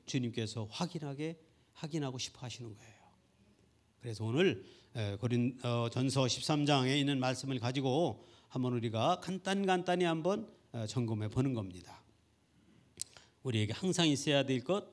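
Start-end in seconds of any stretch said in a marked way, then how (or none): none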